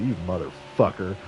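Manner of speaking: low, mock-seething curse; retold low angry insult; The Rock, pissed off. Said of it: "The Rock was pissed off".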